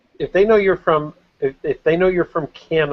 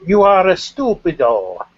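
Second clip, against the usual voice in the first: slow, higher hammed-up Italian accent; mock Italian accent; 5 semitones higher, 3 dB louder than usual. Teasing friend about understanding Italian, mock Italian accent. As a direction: put on a joke Italian accent, drawn out.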